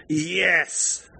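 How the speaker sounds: high, drawn-out yell held back in volume; a restrained cheer of "yes", pitched high; narrated yell. A narrator's reading. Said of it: "started yelling".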